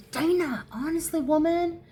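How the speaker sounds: playful exasperation. Pretend. Playfully addresses someone in exasperation.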